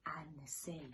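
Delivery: soft whispered close. Acted. Reader to children, soft whisper. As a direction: whisper the ending gently.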